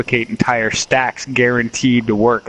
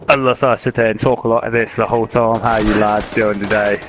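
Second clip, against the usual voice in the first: put-on English accent. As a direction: talk like a lad from England. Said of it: "Now I sound like I'm".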